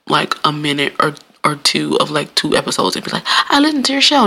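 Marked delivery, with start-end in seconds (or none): 3.3-4.3 high-pitched mock claim of a liar; mocking imitation. Podcaster mimicking people who lie about listening, mockingly. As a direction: mimic a phony fan, pitched well up.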